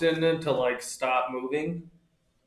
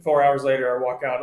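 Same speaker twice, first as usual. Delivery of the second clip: usual speaking voice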